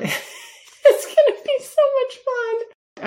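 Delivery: laughing through the words; giggling. Real, not acted. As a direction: giggle it out with delight. Giggling with delight.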